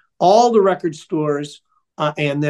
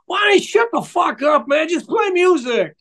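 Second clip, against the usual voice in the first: heckler yelling; 10 semitones higher than usual. Retold or imitated. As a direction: bark it from the back of the dark room. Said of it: "a voice from out of the darkness yells at me".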